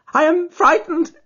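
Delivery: high, loud, slow, near tears; a terrified man close to blubbering; half blubbering with fear. Acted. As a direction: whimper it out, nerves gone. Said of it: "half blubbering with fear".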